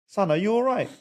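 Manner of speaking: high, exaggerated worried father; father pretending alarm at his son's injury, pitched high; mock concerned dad. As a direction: fuss over the son as if he hit his head. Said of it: "the dad's gonna look around and go".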